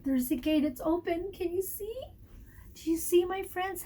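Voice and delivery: ordinary delivery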